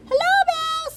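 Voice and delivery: very high squeaky duck greeting; duck character. A narrator's reading. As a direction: call out in a tiny, high-pitched duck voice. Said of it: "said Duck".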